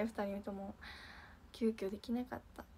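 usual speaking voice

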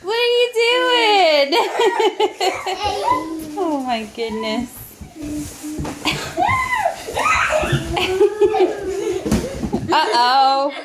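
high, slow, laughing question; amused mom laughing at her son. Real, not acted. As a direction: giggle through it. Laughing at son's antics.

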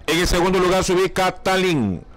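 usual speaking voice

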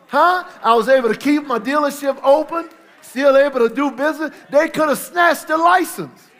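loud, high preaching shout; preaching at full force. Acted. Preacher firing up the congregation, shouting. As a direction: whip the church up, full voice.

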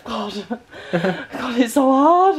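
ordinary talk

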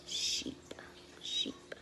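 hushed answer; whisper. Acted. Teacher to little friends, whispered answer. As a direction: whisper the word to the kids.

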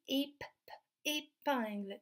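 "épingle" broken at the P, which is popped alone; isolated P sound. Shown as a demonstration. Teacher helping children hear P, sound isolated. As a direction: break off at "ép-", repeat the P, then say "épingle". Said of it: "Est-ce qu'on entend le son".

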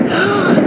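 sharp, loud gasp; surprised gasp. Real, not acted. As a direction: gasp in sudden amazement. Sudden surprise in the game.

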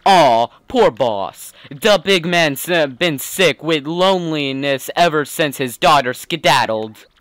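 streetwise gangster's sidekick voice; mob henchman. Acted. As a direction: talk like the boss's loyal goon.

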